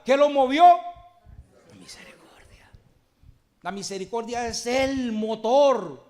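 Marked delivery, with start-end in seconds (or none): 1.7-2.7 a breathy dramatic whisper of the answer. Acted. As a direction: drop to a whisper for the reveal. (susurro dramático)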